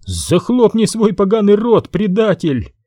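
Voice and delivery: very high, raging insult; angry yell. A narrator's reading. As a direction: pitch leaps up in outrage. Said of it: "заорал Бен".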